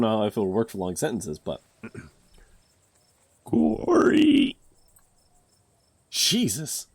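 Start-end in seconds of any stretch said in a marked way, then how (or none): 3.4-4.6 high, loud, eerie call; creepy voice. Acted. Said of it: "I need a creepy voice". 6.1-6.9 high startled exclamation. Real, not acted. Reacts to own creepy voice.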